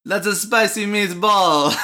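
high, loud, slow stage Italian; cartoon Italian accent. Showing his friend his own Italian, cartoon accent. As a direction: belt it out as a hammy Italian. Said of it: "Let's hear your Italian".

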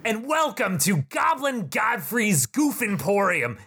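high goblin shout of the shop's name; shouting goblin shopkeeper. Acted. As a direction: announce the store like a carnival barker.

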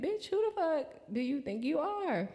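high, sassy family scolding; mock the disapproving relatives; judgy family voice. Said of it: "My family gonna be looking at me like".

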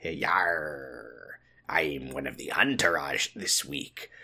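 low, soft pirate growl; lightly voiced pirate. Pirate role for pirate tier.

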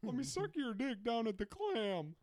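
high, slow squeaky cartoon voice; hammed-up high cartoon character; Family Guy voice.

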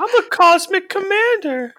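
high, slow, booming announcer-style wrestler boast; wrestler promo voice. Pretend. Friend to co-host, mocking the wrestler's name: high, slow hammed-up promo voice.

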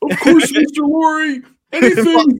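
high-pitched gushing mock eagerness; Nick Sirianni. Coach accepting the owner's call, hammed-up high gushing. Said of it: "They did a dramatic reenactment".

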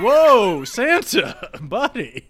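high, loud, taken-aback whoa; acted alarm dissolving into laughter; startled placating. Elves startled by Santa's growl.

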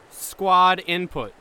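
squad input spelled out slowly; slow emphatic repeat. Acted.

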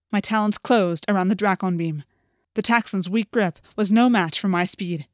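ordinary delivery

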